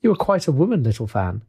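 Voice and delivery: ordinary delivery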